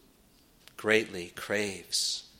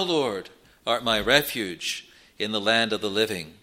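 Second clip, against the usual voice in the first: ordinary talk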